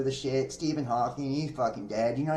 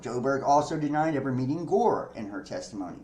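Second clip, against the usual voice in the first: usual speaking voice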